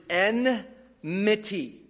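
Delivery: enmity drawn out slowly as EN-mity; careful model pronunciation. Shown as a demonstration. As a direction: teach kids enmity, slow syllables, n first. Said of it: "I want all the kids to say that word".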